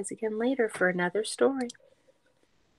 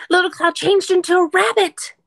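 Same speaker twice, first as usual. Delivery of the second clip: excited; 10 semitones higher, 9 dB louder than usual. Acted. Excited page reveal.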